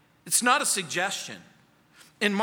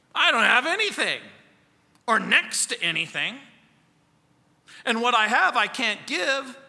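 whiny excuse; 4 semitones higher, 4 dB louder than usual. Retold or imitated. Voicing a reluctant church member.

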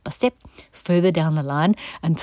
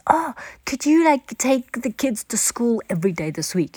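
much higher, sweet coaxing ask; wheedling request; 6 semitones higher than usual. Retold or imitated. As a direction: coax them into a favor. Someone asking a favor.

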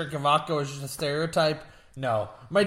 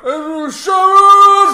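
slow, drawn-out call, much higher and louder; 17 dB louder, much slower than usual. Retold or imitated. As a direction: bellow the wife's name like a dazed rocker.

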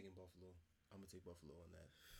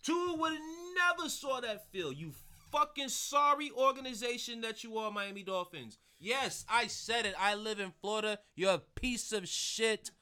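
loud rant; 3 dB louder than usual. Real, not acted. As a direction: rant at the team as if the mic were across the room. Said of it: "We can hear you".

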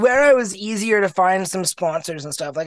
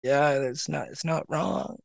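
much softer, sheepish mumble; 4 semitones lower, 10 dB quieter, slower than usual. Retold or imitated. Blind skater admitting the praise is true, softly sheepish. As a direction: shrug it off, embarrassed. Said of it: "just sheepish".

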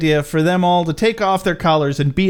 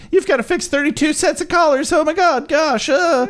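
high, fretful panic voice; worried Morty; 11 semitones higher than usual. Retold or imitated. Morty frets to Rick about the collars, panicky. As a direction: imitate a fretting kid, pitched well up. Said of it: "Morty worries that he has to fix".

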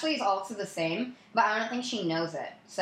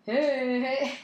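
flirty guy greeting; 6 semitones higher, 4 dB louder than usual. Retold or imitated. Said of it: "every guy in there was like".

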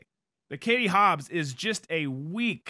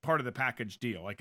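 ordinary talk, nothing marked

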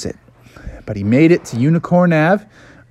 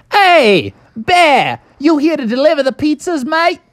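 high, loud Australian dingo boss; Australian accent; 14 semitones higher, 4 dB louder than usual. Acted. Dingo Dan greets Bubby at the door, loud Australian accent. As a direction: call out in a high-pitched Aussie accent. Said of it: "he talked in an Australian accent".

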